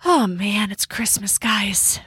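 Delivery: breathy hushed wonder at falling snow; hushed whisper. Real, not acted. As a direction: drop to a whisper, awed. Sees snow start, hushed wonder.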